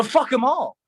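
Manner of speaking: normal talking voice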